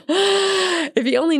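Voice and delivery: amused, still laughing. Real, not acted. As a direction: keep laughing as you say it. Laughing while telling it.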